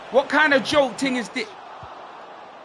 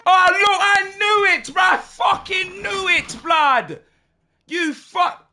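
angry shout; 7 semitones higher, slower than usual. Real, not acted. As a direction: vent at the game. Player gets injured, he predicted it.

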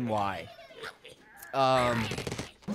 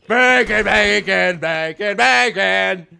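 bacon yell; 4 semitones higher, 12 dB louder, slower than usual. Acted. Yelling bacon catchphrase.

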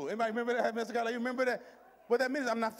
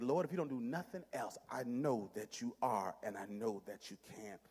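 low, soft, slowing testimony; old-school prayer; 10 semitones lower, 6 dB quieter than usual. Acted. Believer telling God of settled trust, old-school prayer. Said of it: "I'm about to go old school in saying this".

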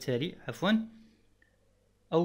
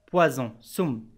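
normal talking voice

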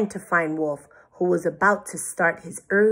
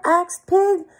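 ordinary delivery, nothing marked

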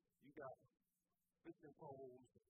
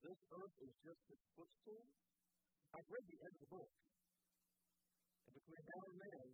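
rising preaching; 4 semitones higher, 5 dB louder than usual. Acted. Preacher builds to a climax.

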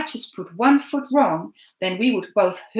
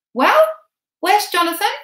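high, loud, pushy man's voice; Hubert insisting; 13 semitones higher, 8 dB louder than usual. A narrator's reading. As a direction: press him for an answer, voice raised. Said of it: "persisted Hubert".

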